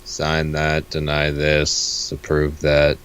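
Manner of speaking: boring monotone. Acted. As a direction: drone it like a tired clerk. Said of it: "very boring monotone voice for this one".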